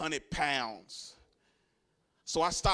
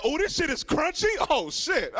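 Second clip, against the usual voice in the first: surprised; 11 semitones higher, 5 dB louder than usual. Retold or imitated. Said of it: "I was like".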